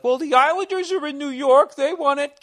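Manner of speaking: high, nasal know-it-all New Yorker; squeaky pedantic New Yorker whine. Retold or imitated. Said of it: "New Yorkers would try to get technical and be like".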